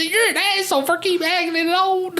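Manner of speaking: high, laughing mock of scolding girls; laughing retelling of the friends' scolding voice; mocking the friends. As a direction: whine it like the offended friends. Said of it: "They said".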